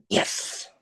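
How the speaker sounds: gleeful whispered hiss; soft, voiceless mock celebration; whispered triumph. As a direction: whisper it like a secret victory. Gleeful reply retold, voiceless.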